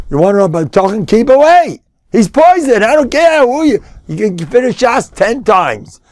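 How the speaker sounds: high, urgent warning from the pulpit; rising into a high, insistent rebuke; forceful preaching. Real, not acted. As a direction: warn them off emphatically.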